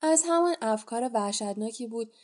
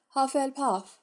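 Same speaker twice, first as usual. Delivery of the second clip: hat shouts; 4 semitones higher, 4 dB louder than usual. A narrator's reading. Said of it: "کلاه فریاد زد".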